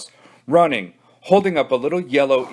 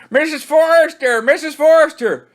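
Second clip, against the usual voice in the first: higher and louder, desperate call; frantic louder kid shout; 5 dB louder than usual. Acted. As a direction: scream it to reach the house. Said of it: "shouted at the top of their lungs".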